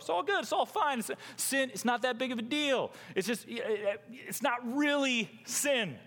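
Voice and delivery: high-pitched mock reassurance; self-deceiving excuses. Self-deceived sinner excusing sin to himself, high mock reassurance. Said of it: "just telling yourself".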